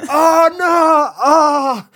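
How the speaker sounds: loud, high drawn-out mock wail; hammed-up howl of pretend blindness; playing blind dad. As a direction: cry out high and long in fake dismay. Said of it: "it was just like".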